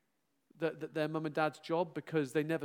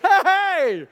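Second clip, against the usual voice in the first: high, loud laughing "Hey!"; laughing shout; 13 semitones higher, 15 dB louder than usual. Real, not acted. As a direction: yelp it out mid-laugh. Laughing outburst at his own joke.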